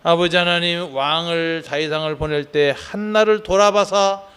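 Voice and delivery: loud, sustained pastoral prayer delivery; fervent public prayer. Real, not acted. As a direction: lift the congregation's prayer up forcefully in a steady, level chant. Leads the closing prayer. (힘주어 외치는 통성 기도)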